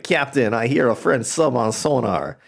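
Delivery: a joke Russian sailor calling his captain; mock Russian sonar man. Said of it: "the Russians be like".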